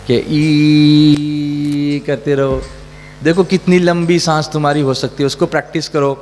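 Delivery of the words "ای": long sustained "eee" on one pitch; odd voice exercise. Shown as a demonstration. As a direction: hold a loud, steady "eee" on one breath. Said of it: "عجیب سی آوازیں نکالنے کے لیے". (ایک سانس میں لمبی، سیدھی "ای" کی آواز)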